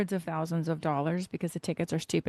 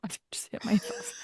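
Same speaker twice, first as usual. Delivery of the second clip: whisper; 6 dB quieter than usual. Real, not acted. Just hit herself with microphone.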